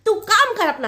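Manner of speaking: high, loud brush-off; snapping. Acted. Mother-in-law snapping the bride off, loud and high. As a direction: bark her back to work.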